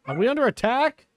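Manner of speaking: high, startled alarm. Real, not acted. Player reacting to sudden chaos in her game, alarmed. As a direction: blurt it out as if the house were under siege.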